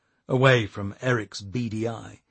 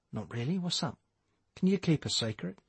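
hushed; 4 semitones higher, 7 dB quieter than usual. A narrator's reading. Hushed secret conversation.

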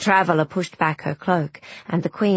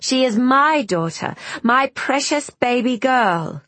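desperate; 7 semitones higher than usual. Acted. Queen refuses to surrender daughter.

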